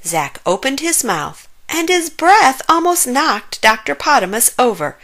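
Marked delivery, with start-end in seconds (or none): none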